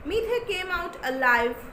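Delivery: ordinary delivery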